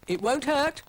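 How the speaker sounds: high, loud reassurance; high coaxing voice. Acted. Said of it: "said Dougal".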